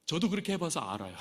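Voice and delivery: usual speaking voice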